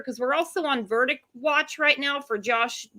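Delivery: ordinary talk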